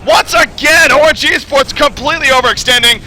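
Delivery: shouted, high-pitched live commentary; excited play-by-play. Acted. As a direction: yell the action as it happens, loud and high.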